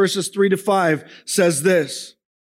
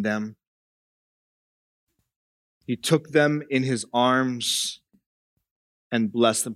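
tender; 7 semitones lower, 4 dB quieter than usual. Acted. To the congregation picturing Jesus holding children, hushed and tender.

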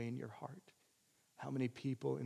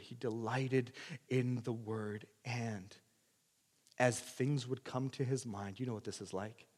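normal talking voice

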